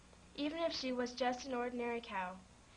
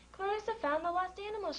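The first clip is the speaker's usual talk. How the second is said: shouting; 8 semitones higher than usual. A narrator's reading. Pete announces to the crowd that the cow found them, high shout. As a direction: call it out to everyone. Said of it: "shouted Pete".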